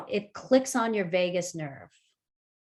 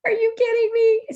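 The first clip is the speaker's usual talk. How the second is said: high, loud "are you kidding me?"; exasperated disbelief; 8 dB louder than usual. Retold or imitated. Teacher mocking a trivial emergency with loud disbelief. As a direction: throw up your hands in disbelief.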